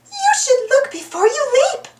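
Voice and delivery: high, fussy duck voice; Daisy voice. A narrator's reading. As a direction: scold lightly like a prim duck. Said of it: "Daisy said".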